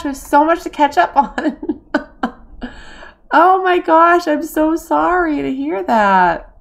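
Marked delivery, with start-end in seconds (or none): 3.3-6.5 loud, high, drawn-out sympathy; shocked sympathy. Real, not acted. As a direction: gush with sorrow for a friend. Reacting to chatter's bad news.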